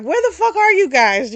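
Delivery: high, loud, irritated call; mock annoyed yell; exasperated shout. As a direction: imitate someone hollering for missing friends. Acted angry call to hidden pair.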